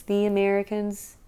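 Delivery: "the Americans" with a sneer on "the"; scornful inflection. Shown as a demonstration. Explaining that "the Americans" has a tinge, sneering on "the". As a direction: lean on "the" to make "the Americans" dismissive. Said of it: "It's all about your inflection".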